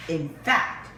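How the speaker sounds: ordinary delivery, nothing marked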